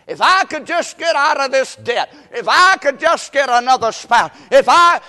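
high, loud wishing, piled up in repeats; mock pleading complaint. Restless believer wishing out of a hard situation, loudly pleading. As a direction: act out a restless believer begging for a way out. Resumes the discontented believer's wishes.

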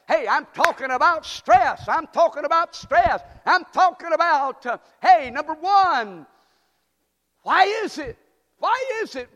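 high, loud preacher's climb; fiery preacher hitting the theme; preaching at full force. Acted. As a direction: build the sermon to a ringing peak.

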